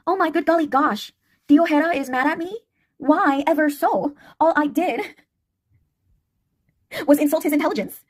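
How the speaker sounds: high, sugary-polite mock-innocent voice with giggles; polite feminine tone. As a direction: play the sweet, polite girl while insulting him. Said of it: "I'll really play up the".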